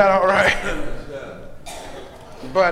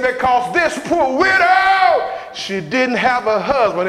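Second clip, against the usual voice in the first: preaching whoop; 2 semitones higher than usual. Acted. Preacher whooping to the congregation about giving. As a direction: moan it out like a preacher.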